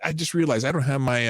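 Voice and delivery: ordinary delivery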